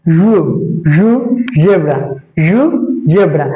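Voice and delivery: normal talking voice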